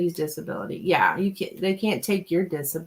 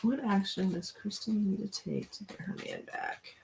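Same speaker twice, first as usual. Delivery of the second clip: softer, murmuring the question to herself; soft murmured question; 10 dB quieter than usual. Shown as a demonstration. Reader quietly rewording the question for the cards. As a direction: mumble the question half to yourself. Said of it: "What is wrong with me?".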